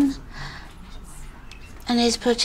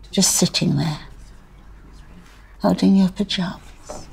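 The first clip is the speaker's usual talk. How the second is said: hushed grief. Acted. Remembering a bereaved child upstairs, hushed grief. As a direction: let it fall to near-whisper. Grieving memory, then plea.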